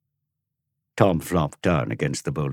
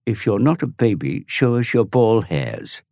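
challenging taunt; 6 semitones higher, 5 dB louder than usual. Acted. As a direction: taunt him with not. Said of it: "Tom challenged him".

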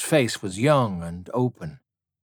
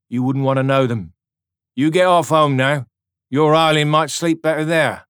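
louder, firmer urging; firm kind soldier; 5 semitones higher, 6 dB louder than usual. A narrator's reading. Soldier to the girl, firm but kind. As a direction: send the girl off home.

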